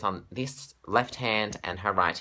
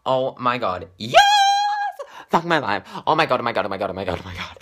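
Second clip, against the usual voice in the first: fangirl freakout; 3 semitones higher, 7 dB louder than usual. Real, not acted. Fan overwhelmed after the Grammys, screaming then fading. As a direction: lose it completely, then wind down. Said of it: "my heart literally is beating out of my chest".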